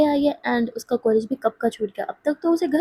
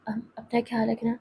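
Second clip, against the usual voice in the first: tender goodbye; 8 dB quieter than usual. Acted. Tender goodbye between friends.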